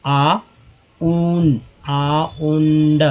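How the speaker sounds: आ and उंड slowly, as separate pieces; broken-down pronunciation. Shown as a demonstration. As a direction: stretch आ, pause, then उंड. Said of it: "इसका उच्चारण होता है आउंड". (आ, उंड को तोड़कर धीरे-धीरे)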